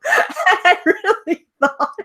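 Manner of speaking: high squealing laugh through words. Real, not acted. Laughing at own belief.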